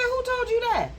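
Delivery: high, loud doubting question; skeptical challenge. Retold or imitated. Therapist doubting the client's neuropathy diagnosis, high and skeptical. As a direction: demand it like you don't buy it.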